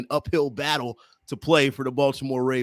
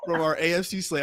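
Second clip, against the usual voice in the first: ordinary talk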